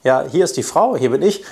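ordinary talk

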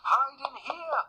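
high, urgent piglet squeal; crying-out little pig. A narrator's reading. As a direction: cry out high in alarm. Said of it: "cried the third little pig".

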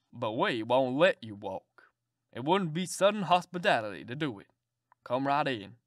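put-on low, hearty old gentleman; a kindly old man ushering a guest in. Old gentleman inviting the stranger in, low and hearty.

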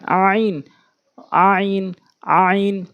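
"আইন" squeezed in the throat and drawn out; pressed throat pronunciation. Shown as a demonstration. Teacher tells learners to press, squeezed long "আইন". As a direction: press hard on the start of "আইন", then hold it. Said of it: "চাপ দিয়ে উচ্চারণ করবেন".

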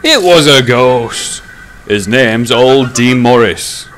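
earnest, drawn-out protest. A narrator's reading. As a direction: insist slowly and emphatically. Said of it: "said Bear earnestly".

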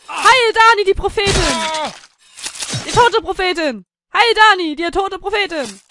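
loud, high ceremonial shout; mock hailing. Follower hailing Dani the prophetess, shouting. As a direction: hail her like a chanting follower.

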